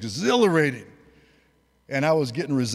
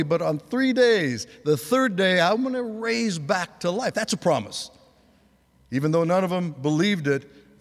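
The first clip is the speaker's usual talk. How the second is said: impassioned preaching; 4 semitones higher than usual. Acted. Pastor proclaiming Jesus's promises to his congregation, impassioned preaching. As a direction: preach it with rising fire.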